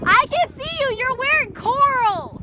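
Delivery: loud excited call, high; shouting across. Real, not acted. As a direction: call out to friends hiding from you. Calling out to hiding friends.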